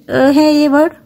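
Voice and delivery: normal talking voice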